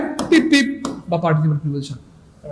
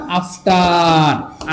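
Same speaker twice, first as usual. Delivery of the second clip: After stretched very long and slow; drawn-out answer; much slower than usual. Shown as a demonstration. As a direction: hold the word after like a chant. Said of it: "আর পরে English কি জানো ভাইয়া?". (After শব্দটা অনেক টেনে, ধীরে বলা)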